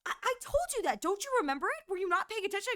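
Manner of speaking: a friend scolding, pitched high; imitating friend. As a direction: squeak up in mock disbelief. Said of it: "She goes".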